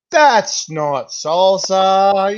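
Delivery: very slow, drawn-out dismay; mock dawning dismay, slow; slow realisation. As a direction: stretch every word as it hits you.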